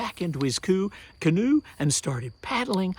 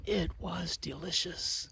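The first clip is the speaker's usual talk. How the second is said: usual speaking voice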